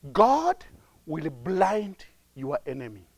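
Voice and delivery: low, hushed pronouncement; confiding. Real, not acted. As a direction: reveal it softly like a secret. Secret told hushed, then repeated.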